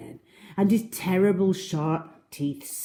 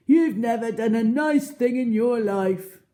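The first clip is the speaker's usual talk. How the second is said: Trunky; 8 semitones higher, 7 dB louder than usual. Acted. Trunky scolds the crocodile.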